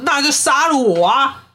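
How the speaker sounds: high-pitched retold shout; mock hysterical yell. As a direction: imitate an out-of-control outburst, pitched high. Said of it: "突然失控大喊说".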